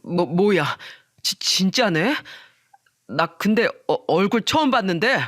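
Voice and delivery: stammering, mildly shocked man; startled. Acted. Friend seeing the wife's business card, startled stammer. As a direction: falter over each opening word. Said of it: "기겁을 하며 깜짝 놀라더군요".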